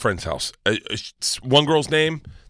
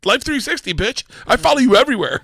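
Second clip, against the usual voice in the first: higher, louder triumphant taunt; gloating shout; 13 semitones higher, 5 dB louder than usual. Retold or imitated. Dad revealing location tracking to his daughter, loud gloating. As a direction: rub it in her face.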